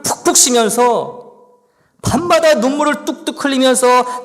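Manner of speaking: the psalmist pouring out his grief to God, anguished; anguished complaint. Acted. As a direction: cry out your sorrow and injustice. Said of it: "하나님께 불평을요, 막 쏟아내고 있는 거예요".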